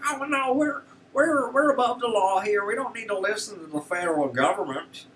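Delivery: mock the governor with an over-the-top Texas accent; mocking the Texas governor in a bad Texas accent. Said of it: "I know it's horrible".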